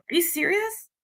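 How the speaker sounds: high-pitched, questioning "really?"; retold incredulity. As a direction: ask it in high-pitched disbelief. Retells own incredulous question to him.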